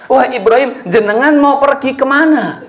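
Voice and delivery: a confused wife calling after her husband; Hajar's voice. Acted. Wife calls after Ibrahim, lightly voiced and bewildered. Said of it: "Istrinya bingung".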